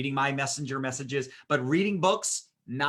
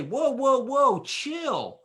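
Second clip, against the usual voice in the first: higher, louder mock dismissive whoa, chill; 10 semitones higher, 4 dB louder, much slower than usual. A manager telling an angry customer to calm down, in a higher, mocking, dismissive voice. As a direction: brush off the upset person like a know-it-all. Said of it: "And I don't say".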